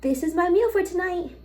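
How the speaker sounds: very high put-on voice mimicking a creator; mocking food creator. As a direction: squeak it out like a smug food poster. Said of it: "and be like".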